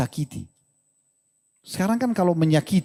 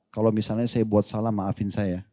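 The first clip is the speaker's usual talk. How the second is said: much softer and lower, a gentle plea; tender apology; 6 semitones lower, 5 dB quieter than usual. Pretend. Wife holding husband's hand, asking forgiveness tenderly. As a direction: ask forgiveness softly, melting him.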